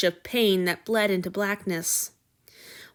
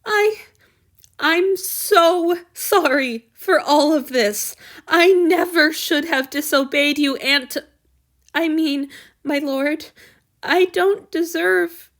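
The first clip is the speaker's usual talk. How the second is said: high, loud, close to sobbing; darkened, tearful apology; 7 semitones higher, 7 dB louder, slower than usual. Acted. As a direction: apologize while breaking down. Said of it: "Han Yu's voice darkened".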